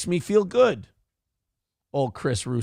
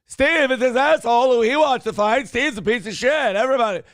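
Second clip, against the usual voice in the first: raised, ranting mock host voice; Kevin Brennan; 6 semitones higher, 3 dB louder than usual. Likening a sports ranter to another host, ranting impression. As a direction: rail about it like an indignant shock jock. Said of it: "Mad Dog Russo is like sports Kevin Brennan".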